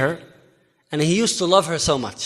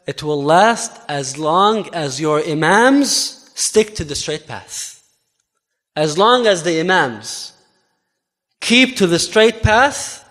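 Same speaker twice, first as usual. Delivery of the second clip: slow, weighty, every word placed; a calm, slow ruling given to a questioner; slow solemn answer; much slower than usual. Retold or imitated. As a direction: lay down the answer slowly, like a verdict. Abu Bakr's solemn answer.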